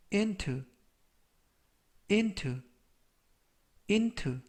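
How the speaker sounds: into said clearly, stress on IN; model pronunciation. Shown as a demonstration. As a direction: pronounce into as IN-too. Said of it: "라고 발음하시면 되겠고요".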